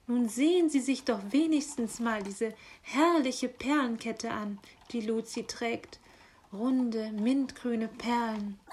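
swooping, delighted emphasis; gushing magpie. Acted. Magpie showing Luzi's necklace to tired raven, gushing. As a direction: urge the listener to admire every detail. Magpie gushes over calendar picture.

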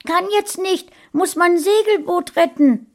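put-on very high, excited child's voice; the son dashes from the room, calling back in a high child voice; little boy calling out. A narrator's reading. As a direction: shout it like a kid running off to play. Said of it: "Dabei rief er".

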